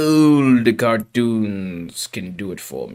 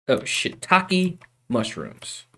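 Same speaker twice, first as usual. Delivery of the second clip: normal talking voice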